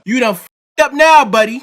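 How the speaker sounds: loud mocking call-out; taunting. Real, not acted. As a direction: gloat at the man who got caught. Taunting man caught on camera.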